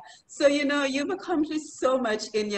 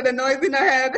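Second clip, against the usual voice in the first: the noise in her head, with a Dominican lilt, louder; Dominican accent; 4 semitones higher, 5 dB louder than usual. Retold or imitated. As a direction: repeat it the Dominican way. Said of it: "Like we say in Dominica".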